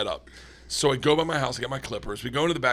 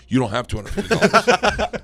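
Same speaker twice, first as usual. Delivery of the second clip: loud, disbelieving retort; incredulous; 5 semitones higher, 6 dB louder than usual. Retold or imitated. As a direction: scoff at the kid loudly. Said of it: "I said".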